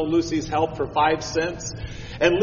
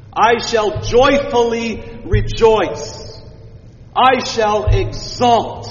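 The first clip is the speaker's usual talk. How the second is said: slow, higher and louder with elation; jubilant; 5 semitones higher, 4 dB louder, slower than usual. Shown as a demonstration. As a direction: proclaim it with soaring joy. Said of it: "It's elation. It's jubilation".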